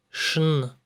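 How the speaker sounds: шн said as a hard ш then н; шн sound. Shown as a demonstration. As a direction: say шн, ш plus н. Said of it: "pronounced like".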